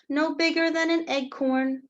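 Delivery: usual speaking voice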